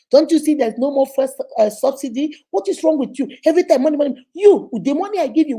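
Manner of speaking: loud, fast, exasperated scolding; mock angry husband, loud and quick; irritated husband. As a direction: tell her off, fed up with money talk.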